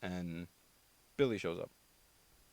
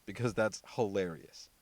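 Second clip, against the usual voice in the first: ordinary talk, nothing marked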